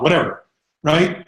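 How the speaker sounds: usual speaking voice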